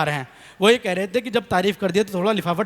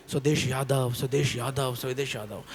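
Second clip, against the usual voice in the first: name repeated softer and softer like an echo; fading echo; 7 semitones lower, 7 dB quieter than usual. Retold or imitated. Echo of the name fading off the water. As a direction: let each repeat die away further, like a cave echoing back. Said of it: "आवाज़ लौट के आ गई".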